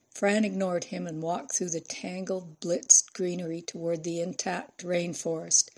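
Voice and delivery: plain reading voice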